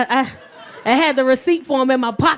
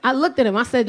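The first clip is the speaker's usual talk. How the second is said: usual speaking voice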